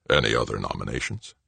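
low, softly rising question with a coy grin. A narrator's reading. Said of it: "Grinning coyly, Arnold asked".

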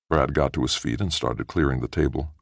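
ordinary delivery

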